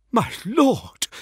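high, gasped protest; shocked gasp. A narrator's reading. Envoy appalled by his lord's arrogance, gasping. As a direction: gasp it, aghast. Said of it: "gasped Braxton".

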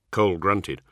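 ordinary delivery, nothing marked

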